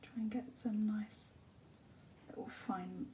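whispered painting commentary; soft ASMR whisper. Acted. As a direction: breathe the words as you paint.

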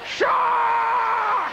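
high, drawn-out bellow; big loud shout. Acted. First Aussie to meet a shark, loud shout. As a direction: holler it long and high across the water. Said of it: "loud word".

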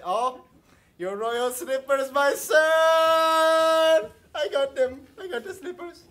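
high, loud gleeful shout; excited calling out. Acted. Servant shouting to her son about the slippers. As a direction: crow about the find.

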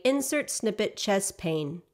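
slow, clear spoken command. Shown as a demonstration. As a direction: dictate each word slowly and distinctly. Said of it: "simply say".

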